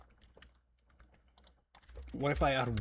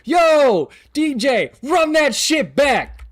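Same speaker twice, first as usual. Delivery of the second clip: hyped; 11 semitones higher, 9 dB louder, slower than usual. Real, not acted. Hype call to replay clip.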